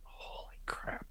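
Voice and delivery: stunned breathless whisper, slow. Retold or imitated. Reacting to what Sister Ernestine said, stunned whisper. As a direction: breathe it out in shock.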